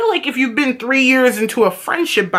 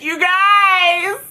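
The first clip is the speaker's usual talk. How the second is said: higher, stretched-out gushing; imitating Jules; 6 semitones higher, much slower than usual. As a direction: drag out the words sweetly, pitched up. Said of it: "And she's like".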